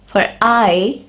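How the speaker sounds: normal talking voice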